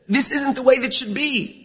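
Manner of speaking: ordinary talk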